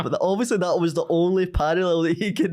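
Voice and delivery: ordinary talk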